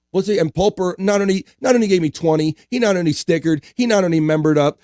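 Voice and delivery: high, rising build to a loud reveal; excited hype announcement. Real, not acted. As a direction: list the gifts up to a big finish. Host hypes a viewer's gift.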